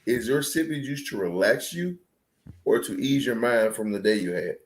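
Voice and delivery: usual speaking voice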